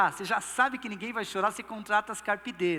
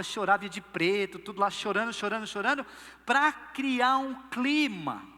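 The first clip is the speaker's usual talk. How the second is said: usual speaking voice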